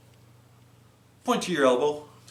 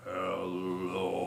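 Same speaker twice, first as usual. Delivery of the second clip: deep, soft rumbling hello; very low hello; 8 semitones lower, 11 dB quieter than usual. Shown as a demonstration. Librarian leading kids, hello as low as can be. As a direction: drop to the bottom of your voice. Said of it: "Let's say hello as low as we can".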